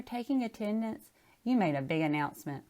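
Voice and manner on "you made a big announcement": plain reading voice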